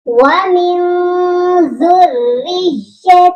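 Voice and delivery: ذریتی chanted slowly with doubled r and y; doubled letters. Shown as a demonstration. Teacher demonstrates the stressed doubled letters, slowly. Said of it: "را بھی مشدد ہے".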